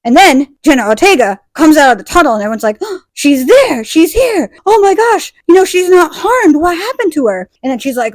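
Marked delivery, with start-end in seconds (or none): 2.8-7.5 high-pitched acted amazement; mock astonishment. Townspeople see Jenna come out, high mock astonishment. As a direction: exclaim high, like an amazed crowd.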